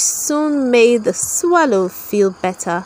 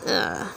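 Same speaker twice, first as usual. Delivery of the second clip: disgusted ugh; 10 dB quieter than usual. Acted. Disgust at the mole's proposal.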